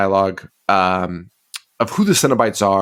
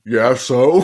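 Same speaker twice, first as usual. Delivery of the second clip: laughing mock Cenobites. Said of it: "and they're like".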